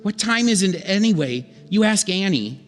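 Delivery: normal talking voice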